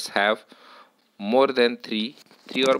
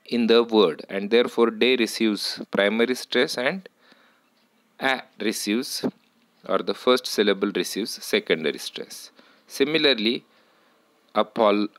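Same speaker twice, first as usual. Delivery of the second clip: ordinary delivery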